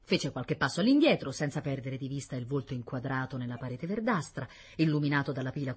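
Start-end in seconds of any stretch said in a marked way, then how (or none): none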